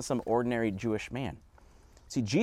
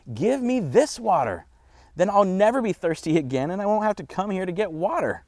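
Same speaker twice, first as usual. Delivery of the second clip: high, loud acted pleading; excited woman's request, pitched up; eager plea; 8 semitones higher, 6 dB louder than usual. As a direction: beg for it with hammed-up eagerness.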